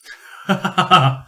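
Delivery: short amused laugh; a dry chuckle at the suggestion; one quick knowing laugh. Acted.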